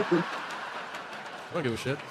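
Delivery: slow, breathy words through laughter; laughing it off. Retold or imitated. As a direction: wheeze it out while cracking up. Italian friend laughing off slur.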